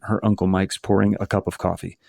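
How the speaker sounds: ordinary delivery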